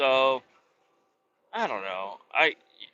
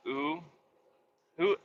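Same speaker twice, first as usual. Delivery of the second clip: ordinary talk, nothing marked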